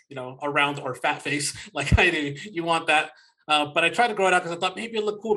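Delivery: normal talking voice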